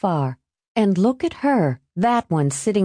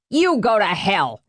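snarled; 2 semitones higher than usual. Acted. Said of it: "he snarled".

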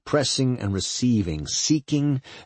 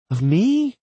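high, louder, taken-aback question; 12 semitones higher, 5 dB louder than usual. Acted. Woman asks if partner fears her, taken aback. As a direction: lift it right up in disbelief.

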